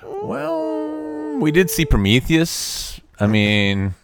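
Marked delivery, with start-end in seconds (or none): 0.0-1.4 high squeaky falsetto. Pretend. Jokey high falsetto exchange.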